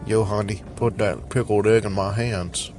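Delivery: lightly voiced street-corner buyer; a hint of a slick dealer asking for pickled eggs; half-hearted street slang voice; street hustler voice. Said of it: "I don't know what that was".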